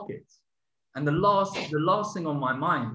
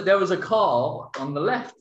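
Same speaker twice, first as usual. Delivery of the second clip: ordinary talk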